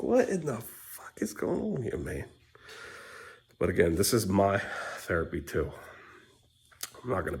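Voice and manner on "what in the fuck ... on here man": high pitched disbelieving rant; mock bewilderment. Dad ranting about the election in high disbelief. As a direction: squeak it out in disbelief.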